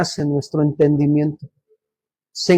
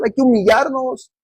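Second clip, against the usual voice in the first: ordinary delivery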